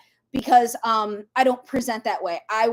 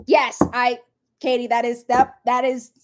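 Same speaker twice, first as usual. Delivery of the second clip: ordinary talk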